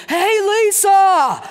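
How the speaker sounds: loud, high, drawn-out holler; imitating a booming voice from the stands; booming shout. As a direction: yell it across a stadium, stretching the name. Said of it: "this booming voice just came out of the stands".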